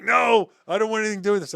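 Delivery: high, loud, fast alarmed protest; panicked refusal. Retold or imitated. Coworker refusing the secret folder, high and panicky. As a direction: back away from the folder like it burns.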